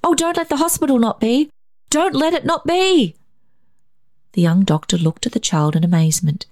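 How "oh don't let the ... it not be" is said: put-on very high, pleading child's cry; distressed plea. A narrator's reading. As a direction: beg like a desperate little boy. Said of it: "he cried".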